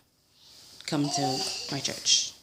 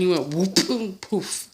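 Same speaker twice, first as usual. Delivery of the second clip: ordinary delivery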